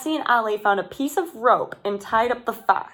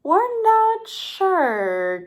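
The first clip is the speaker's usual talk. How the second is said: doubtful producer; 9 semitones higher, much slower than usual. A narrator's reading. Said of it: "Cassie said".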